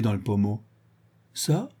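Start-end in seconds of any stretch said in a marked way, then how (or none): 1.3-1.7 high, rising, puzzled question; surprised. A narrator's reading. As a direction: ask it taken aback. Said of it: "d'un air surpris". (question surprise, aiguë)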